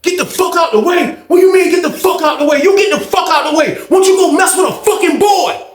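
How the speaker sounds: high furious retort; angry shout back. Retold or imitated. OG yelling back at old head, high shout. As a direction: throw his words back, yelling.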